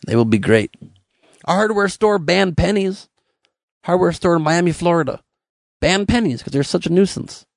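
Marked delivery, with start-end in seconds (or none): none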